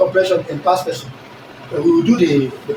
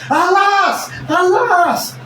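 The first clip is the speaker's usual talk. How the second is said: dramatic lament; 11 semitones higher, much slower than usual. Shown as a demonstration. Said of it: "Exclamation mark".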